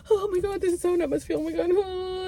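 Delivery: high, whiny near-crying; a teary, high-pitched lament; whimpering distress. Retold or imitated. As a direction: whimper it as if freezing. Freezing cold, distressed.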